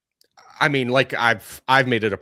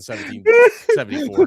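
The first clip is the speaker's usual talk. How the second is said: mock patriotic rant; 9 dB louder than usual. Patriotic rant against the British.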